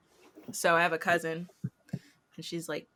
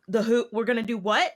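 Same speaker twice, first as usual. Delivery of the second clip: retold surprise; 5 semitones higher, 6 dB louder than usual. Reacting to the child's big word.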